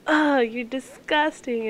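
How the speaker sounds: slow, drawn-out "ugh" of fake disgust; mock disgust. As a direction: tease a friend with playful disgust. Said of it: "and she was just like".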